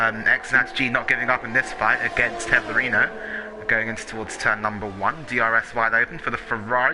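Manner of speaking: ordinary delivery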